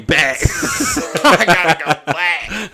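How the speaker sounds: loud, high, cracking up mid-sentence; laughing. Real, not acted. Friend hanging up on Mike's joke, laughing through it. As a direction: break into real laughter on the punchline.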